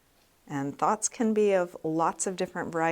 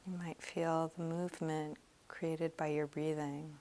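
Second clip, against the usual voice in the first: soft, slow meditation voice; guided meditation; 9 dB quieter than usual. Real, not acted. Meditation teacher leading students through a body scan, soft and slow. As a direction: guide them gently through a body scan, leaving long pauses.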